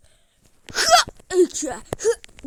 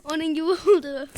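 ordinary talk, nothing marked

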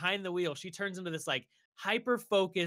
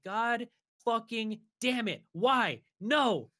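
higher, slow anguished protest; despairing groan; 8 semitones higher, much slower than usual. Retold or imitated. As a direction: mourn the spoiled game. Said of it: "And I'm like".

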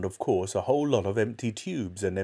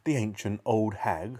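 plain reading voice

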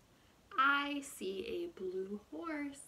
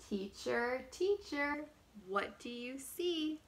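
ordinary delivery, nothing marked

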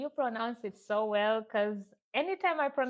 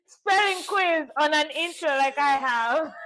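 laughing; 8 semitones higher, 5 dB louder than usual. Real, not acted. Guest laughing at spelling intro.

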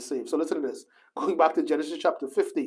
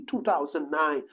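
ordinary talk

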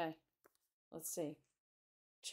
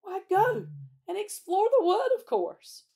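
high, loud and keen; eager enthusiasm; 13 semitones higher, 12 dB louder than usual. A narrator's reading. Peter proposes exploring the wood, enthusiastic. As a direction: burst out with the obvious plan.

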